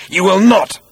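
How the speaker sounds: high, loud refusal; loud defiance. A narrator's reading. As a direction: fling the refusal up and out. Zaphod refuses the robot.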